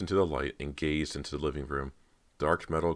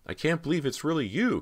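much higher, close to tears; emotional cry; 13 semitones higher, 4 dB louder than usual. A narrator's reading. Cody finding her lost sister, crying out. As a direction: let the feeling burst out, near crying. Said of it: "Cody cried".

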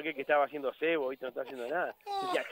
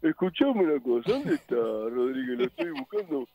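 imitación del jefe; 4 dB louder than usual. Retold or imitated. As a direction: demand an answer as the mimicked boss, rising at the end. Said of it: "logré imitar a un jefe". (voz impostada de jefe)

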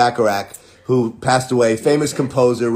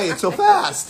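Agumon voice; slower than usual. Acted. Agumon voice greeting fans.

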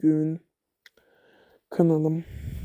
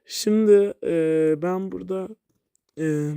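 normal talking voice

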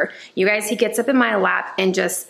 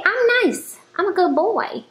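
much higher cutesy puppy voice; voicing the dog; 10 semitones higher than usual. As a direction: speak for the dog like a sweet little pup.

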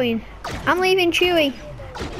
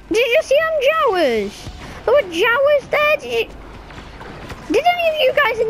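excited; 9 semitones higher than usual. Real, not acted. Spotting Jawas in game.

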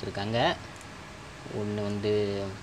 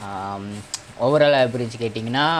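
ordinary talk

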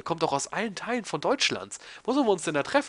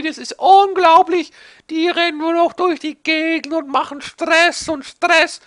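much higher, loud, slow cranky geezer; grumpy old man; 14 semitones higher, 9 dB louder, slower than usual. Pretend. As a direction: rant like an old man scolding kids. Lecturing about today's youth.

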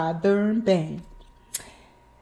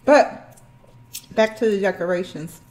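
speaking between bites; chewing food while talking; 3 semitones lower than usual. Real, not acted. Seen 4: Clearly chewing and biting food while speaking; reference frames show no eating.

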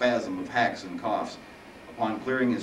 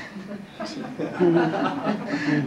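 laughing; 9 semitones higher, 4 dB louder than usual. Real, not acted. Reader laughs at mistake.

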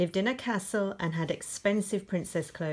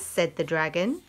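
ordinary delivery, nothing marked

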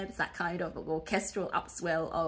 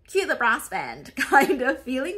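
laughing; 5 semitones higher, 10 dB louder than usual. Real, not acted. Teacher jokes about patriotic music.

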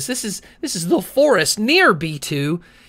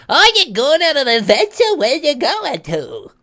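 put-on old man voice; 9 semitones higher than usual. Old mustached man asks.

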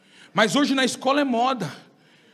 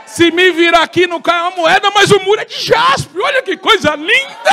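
higher, louder marvelling shout; rapturous preaching; 7 semitones higher, 7 dB louder than usual. Acted. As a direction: marvel aloud at the golden street. Marvelling at heaven.

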